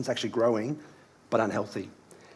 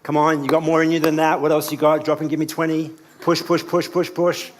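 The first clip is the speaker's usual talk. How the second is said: pushy gym trainer urging on; barking personal trainer; 3 semitones higher, 4 dB louder than usual. Acted. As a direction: bark orders like a fitness coach. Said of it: "I'm like a personal trainer".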